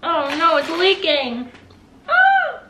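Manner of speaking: alarmed rising yelps; startled cry as it spills; surprised. Real, not acted. Food leaking onto her.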